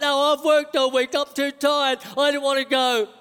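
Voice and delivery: protesting flesh guy. Acted. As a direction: whine your refusal like an overworked flesh. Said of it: "flesh is like".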